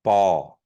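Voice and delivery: Ball with a tight unaspirated b; bracketed B. Shown as a demonstration. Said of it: "The bracketed B".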